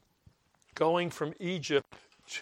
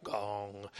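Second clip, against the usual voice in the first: imitating a gong; 7 semitones lower, 10 dB quieter than usual. Shown as a demonstration. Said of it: "we get the word gong".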